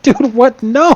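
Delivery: high, loud, startled yelp of disbelief; shocked refusal. Retold or imitated. Refusing the druid's bear-form proposal with a loud, high, shocked no. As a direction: cry out in mock horror at the offer.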